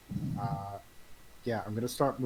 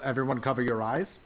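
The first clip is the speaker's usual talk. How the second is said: yelling; 2 semitones higher, 5 dB louder, faster than usual. Acted. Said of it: "I'm gonna yell".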